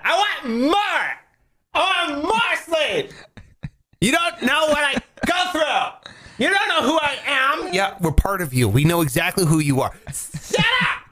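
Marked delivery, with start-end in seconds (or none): 0.0-7.7 high, whining spoiled brat; whiny spoiled pouty drawl. Retold or imitated. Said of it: "you sound like a spoiled brat". 10.1-11.0 bratty shout. Retold or imitated. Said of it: "brain voice".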